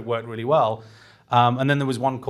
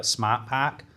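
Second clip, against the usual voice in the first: put-on Boston accent. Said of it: "I did it in a Boston accent".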